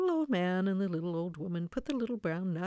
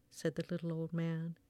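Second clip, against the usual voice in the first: usual speaking voice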